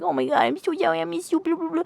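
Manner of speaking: high mock-gushy lovesick voice, then a dismissive trail-off; mocking sappy lovers. A fed-up reader mimicking the lovers' reunion in a high, syrupy mock voice. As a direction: sneer at the mushy reunion in a syrupy falsetto.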